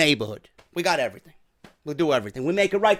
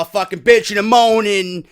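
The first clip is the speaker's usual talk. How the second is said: ordinary delivery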